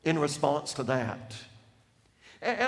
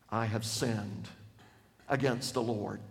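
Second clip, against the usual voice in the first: contrite confession; 8 semitones lower, 6 dB quieter than usual. Acted. David confesses, voiced low.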